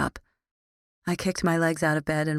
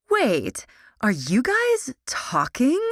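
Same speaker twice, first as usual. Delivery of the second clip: high, slowed excited question; 7 semitones higher, 4 dB louder, much slower than usual. Acted. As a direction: gush with sudden excitement. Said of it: "Alexandra shouted with excitement".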